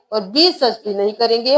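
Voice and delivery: ordinary delivery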